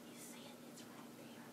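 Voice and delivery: soft unvoiced whisper. Classmate whispering in class about the green mess. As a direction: hush it like a classmate passing a secret. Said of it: "they kept whispering".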